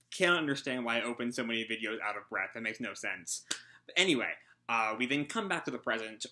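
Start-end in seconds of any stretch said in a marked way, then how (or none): none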